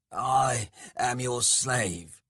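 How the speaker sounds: drawn-out servile voice; abject servant. Acted. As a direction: pledge yourself slowly to your masters. Skalg abases himself before his gods.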